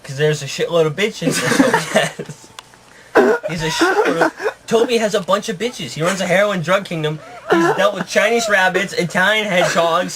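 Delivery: high-pitched, talking through laughter; real giggles breaking into the words; laughing. Real, not acted. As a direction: try to tell it while cracking up. Storyteller cracks up defending his story.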